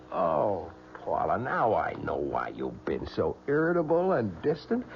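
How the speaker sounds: ordinary talk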